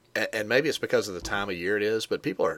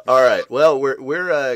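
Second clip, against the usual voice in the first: loud laughing restart; 5 semitones higher, 10 dB louder than usual. Real, not acted. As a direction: laugh it off, then carry on. Recovering from group laughter.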